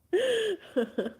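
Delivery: high, loud burst of laughter; loud high-pitched giggle; sudden laugh. Real, not acted. Laughing after her odd line.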